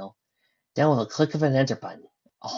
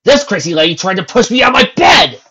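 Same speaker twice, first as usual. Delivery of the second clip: much higher and louder yell; yelled complaint; 8 semitones higher, 14 dB louder than usual. Acted. Neo yelling to his brother about Lucy, loud. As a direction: yell the accusation.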